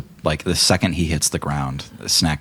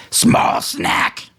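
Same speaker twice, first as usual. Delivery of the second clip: hissed taunt; 4 dB louder than usual. Acted. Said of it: "looks at Snack and says".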